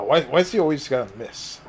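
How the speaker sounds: ordinary talk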